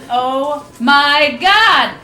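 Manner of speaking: very slow, drawn-out disbelief; shocked gasp. Acted. Catching her date kissing someone, stunned and slow. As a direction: let the shock stretch every word. Spotting Sean kissing another woman.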